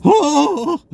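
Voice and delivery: sudden high, loud startled yelp. Retold or imitated. Waking up startled next to his girlfriend, high yelp. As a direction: jolt awake with a sharp cry. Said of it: "I'd wake up going".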